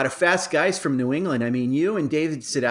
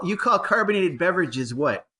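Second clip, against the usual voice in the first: ordinary delivery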